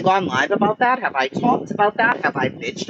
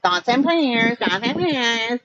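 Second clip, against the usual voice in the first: higher, drawn-out taunting chant; put-on singsong mockery, pitched up; mocking singsong imitation; 4 semitones higher, slower than usual. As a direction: mimic a jeer, stretching out "prayers". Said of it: "That's how it was said".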